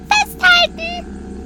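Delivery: high, slow drawn-out hold on; excited warning. Acted. Telling the children to hold on at launch, high and drawn out. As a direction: stretch it out as the ride starts. Time machine about to launch.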